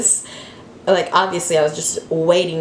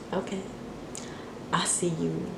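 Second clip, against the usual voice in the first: knowing whisper under the breath; 15 dB quieter than usual. Retold or imitated. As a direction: whisper it like you caught him out. Spotting ex's gift to another girl.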